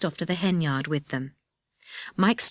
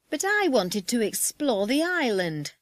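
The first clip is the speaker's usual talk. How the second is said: high, whining protest; sulky; 6 semitones higher than usual. Acted. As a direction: protest like a thwarted sulker. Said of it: "said Eddie in a sulky voice".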